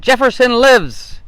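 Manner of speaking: loud, slow and high, like a dying declaration; hammed-up solemn last words; dramatic proclamation. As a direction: proclaim it slowly as a famous last word. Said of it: "The other fella said".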